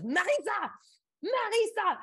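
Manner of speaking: high, loud mock outraged scolding; hammed-up scandalized teacher cry; teacher going nuts. French teacher sending student to the principal, mock frantic outrage. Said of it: "she goes nuts".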